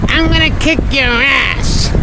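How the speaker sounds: high, put-on squawky parrot threat. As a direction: play the tough little parrot. Parrot voiced in joke.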